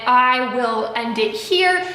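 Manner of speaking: usual speaking voice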